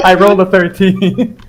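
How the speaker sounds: words spoken through laughter; laughing. Real, not acted. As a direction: giggle it out. Player laughing at his roll.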